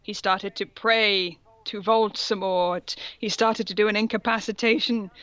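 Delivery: normal talking voice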